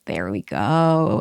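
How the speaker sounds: therapist approval. Retold or imitated. Therapist voiced approving breakthrough.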